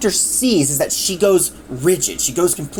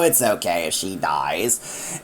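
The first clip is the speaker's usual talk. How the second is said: much lower, softer and slow; cold Frederick; 11 semitones lower, 5 dB quieter, slower than usual. Retold or imitated. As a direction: drop the voice low and slow, coldly. Frederick voiced in retold scene.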